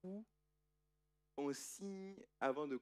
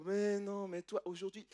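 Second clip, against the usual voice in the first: insistent uncle; 8 semitones higher, 7 dB louder than usual. Acted. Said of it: "Laban dit".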